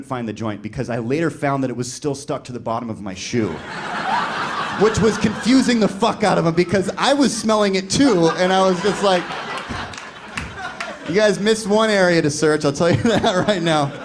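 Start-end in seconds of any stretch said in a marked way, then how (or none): 3.6-4.8 laugh. Real, not acted. Laughing at own story. 4.8-13.9 laughing. Real, not acted. Laughing while telling the punchline.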